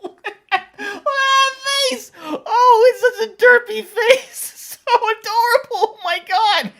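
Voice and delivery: high-pitched laughing gush; laughing delight. Real, not acted. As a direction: squeal through laughter at the derpy face. Laughing at derpy cat face.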